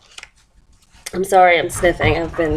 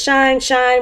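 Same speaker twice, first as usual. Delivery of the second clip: higher, sing-song voice; shining sun; 6 semitones higher than usual. A narrator's reading. Sun peeping in at the window, high sing-song. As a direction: lightly chant it as the sun. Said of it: "went the sun".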